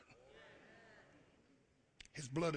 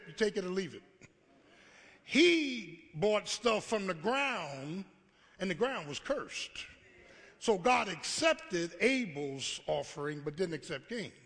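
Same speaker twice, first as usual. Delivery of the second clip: usual speaking voice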